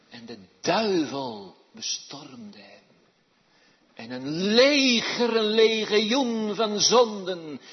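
loud, high, driving sermon voice; fiery preaching. Acted. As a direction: preach it to the whole church. Preaches with full force. (vurig en luid preken)

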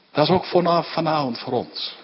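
usual speaking voice

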